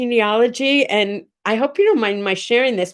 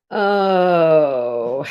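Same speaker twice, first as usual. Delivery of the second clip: long, lower, falling "oh"; sad moan; 5 semitones lower than usual. Real, not acted. Streamer reacting to sad messages, long sorrowful moan. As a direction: let out a drawn-out, sorrowful oh.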